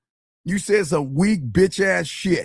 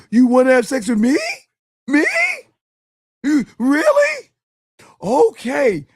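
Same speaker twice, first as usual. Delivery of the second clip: louder, higher mock disbelief; overexcited guy; 4 semitones higher, 4 dB louder than usual. Mocking a guy shocked that a woman said yes, louder and higher. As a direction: gush like a guy who can't believe his luck.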